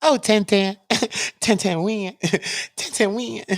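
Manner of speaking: high, mocking lady voice; sing-song teasing lady voice; light falsetto taunt. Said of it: "That's the lady voice, not me".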